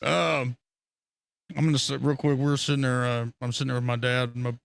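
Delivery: ordinary delivery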